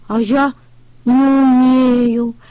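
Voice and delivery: slow, drawn-out sad admission; sad reply. A narrator's reading. Трактор грустно признаётся утятам, медленно и печально. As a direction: let the tractor sound sorry for himself. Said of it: "печально ответил трактор".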